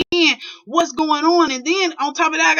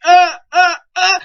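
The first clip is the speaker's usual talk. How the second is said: scared stammer; 6 semitones higher, 8 dB louder than usual. Retold or imitated. Frozen scared response.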